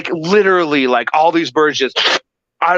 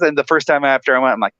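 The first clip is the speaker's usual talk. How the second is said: usual speaking voice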